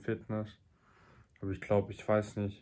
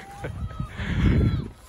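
laughing; 7 dB louder than usual. Real, not acted. Laughs after the shout.

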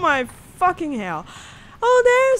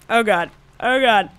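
ordinary talk

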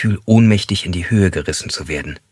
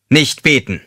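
curt rebuke; 7 semitones higher, 3 dB louder than usual. Acted. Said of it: "wies er sie schroff zurecht".

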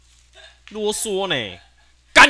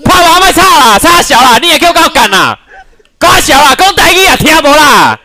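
loud, higher, slow raging shouts; furious screaming; 7 semitones higher, 7 dB louder than usual. Real, not acted. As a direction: scream every word in full fury. Raging at game, yelling. (暴怒大吼)

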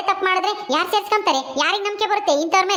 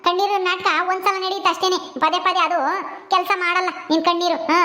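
usual speaking voice

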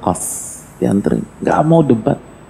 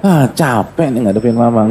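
higher, petulant told-you-so at Mama; told-you-so son; 6 semitones higher than usual. Acted. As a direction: needle Mama like a sulky grown son. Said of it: "Abu Hanifah nggak bilang".

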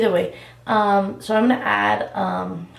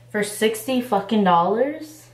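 normal talking voice

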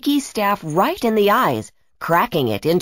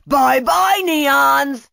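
high, shouted mocking farewell; gloating villain; 6 semitones higher, much slower than usual. Brachus fires on the Neons, gloating. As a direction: bid your victims a taunting goodbye. Villain taunts before firing.

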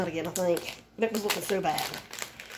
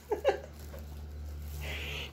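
high breathy laugh; 9 dB quieter than usual. Real, not acted. Laughs at own voice.

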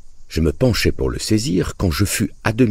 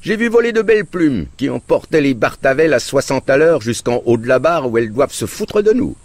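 high, loud sarcastic ridicule; mock him with big sarcasm; sarcastic mockery; 10 semitones higher, 4 dB louder than usual. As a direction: uncle mocking the father, high and loud. Uncle's mocking tirade continues.

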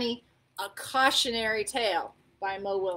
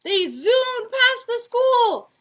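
loud, high, frantic narration; urgent storytelling; 6 semitones higher, 9 dB louder than usual. A narrator's reading. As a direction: race to the rescue as you read. Narrates the frantic run back.

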